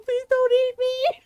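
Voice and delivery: put-on slow, high, loud wail of a scared child; mock shrieking. Little brother clinging to his sibling, mock shrieking. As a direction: draw out the terrified plea like a crying boy. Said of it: "and he's shrieking".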